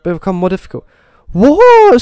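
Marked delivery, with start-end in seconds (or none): 1.3-2.0 much higher, loud disbelieving cry; baffled outrage at the rules; incredulous what. Real, not acted. Confused by game instructions.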